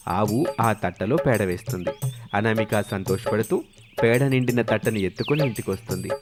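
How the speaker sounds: ordinary delivery, nothing marked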